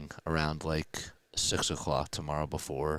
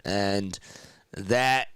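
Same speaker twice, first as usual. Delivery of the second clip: rising, louder excited sports call; play-by-play call; 7 semitones higher, 6 dB louder than usual. Real, not acted. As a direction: call the final drive live, building up.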